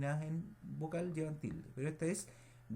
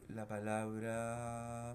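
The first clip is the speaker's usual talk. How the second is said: normal talking voice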